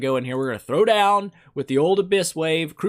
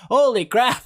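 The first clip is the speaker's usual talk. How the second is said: ordinary talk, nothing marked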